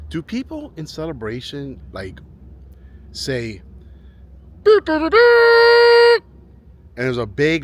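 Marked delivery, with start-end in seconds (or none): 4.6-6.2 very high, loud siren beeps; imitated siren. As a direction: imitate a blaring siren, last beep held long. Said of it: "like, siren".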